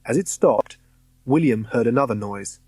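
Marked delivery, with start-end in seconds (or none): none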